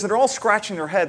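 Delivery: ordinary talk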